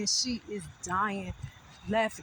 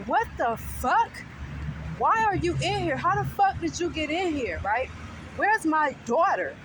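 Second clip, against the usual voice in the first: retold outrage, raised pitch and volume; indignant confrontation; 7 semitones higher, 4 dB louder than usual. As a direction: demand answers from an intruder, acted out. Acted confrontation with intruder in her house.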